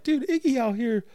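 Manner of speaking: high-pitched mock disbelief; hammed-up astonishment; retold shocked reaction. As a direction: squeal it incredulously, half laughing. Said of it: "So I was like".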